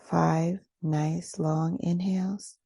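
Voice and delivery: ordinary delivery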